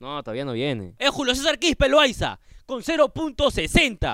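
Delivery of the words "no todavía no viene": much lower and softer, just for mom; quiet phone reply. Real, not acted. Son answering his mother on speakerphone, softly.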